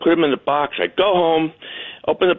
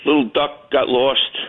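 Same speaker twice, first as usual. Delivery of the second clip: ordinary delivery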